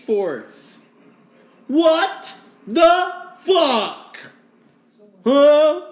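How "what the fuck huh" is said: drawn-out, high, loud what the fuck; shocked outburst. Real, not acted. Reacting to the news with a loud, drawn-out shock. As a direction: stretch it out in loud disbelief.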